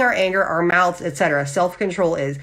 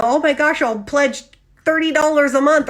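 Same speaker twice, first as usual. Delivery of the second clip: mock gushing donor; 7 semitones higher than usual. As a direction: play someone carried away by a sad ad.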